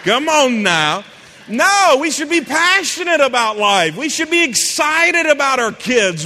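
loud, high, impassioned exhortation; rally the congregation to passion; full-force preaching. Acted. Preacher urging the congregation to be excited about life, shouting.

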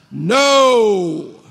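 loud, drawn-out no; preacher's booming answer to his own question; emphatic refusal. Real, not acted. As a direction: belt out a long, resounding denial.

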